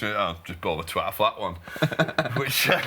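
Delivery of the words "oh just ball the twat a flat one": Jimmy impression. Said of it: "I love your Jimmy impression".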